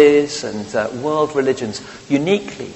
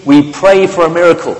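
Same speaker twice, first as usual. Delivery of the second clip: quoted headline; 5 semitones higher, 8 dB louder than usual. Retold or imitated. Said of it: "says Ericsson".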